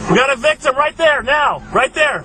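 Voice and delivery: high, loud urgent radio call; urgent shout. Real, not acted.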